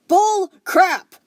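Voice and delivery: high, loud angry snap; snapping. Acted. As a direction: bite back hard. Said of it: "the runty court snapped".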